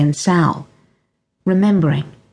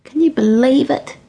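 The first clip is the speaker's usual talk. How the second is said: groaning Jessie; 7 semitones higher than usual. Acted. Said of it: "she groaned".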